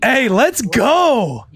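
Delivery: drawn-out high cheer; slow, high welcoming hype call. Real, not acted. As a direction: stretch it out like a welcome chant. Greeting friend on arrival.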